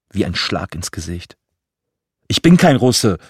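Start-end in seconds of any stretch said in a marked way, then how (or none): 2.3-3.2 loud, high shout of denial; yelled. Acted. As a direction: hurl it back at the bully, full voice. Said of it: "brüllte ich".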